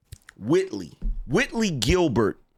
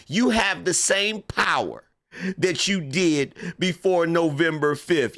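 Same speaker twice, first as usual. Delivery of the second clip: Kamala Harris. Acted. Comedian mocking a politician's post-election pep talk, impersonation. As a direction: send up a politician's pep talk in deliberate phrases. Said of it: "a Kamala Harris impersonation".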